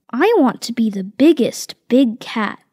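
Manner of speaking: ordinary delivery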